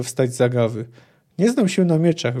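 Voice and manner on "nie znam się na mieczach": ordinary delivery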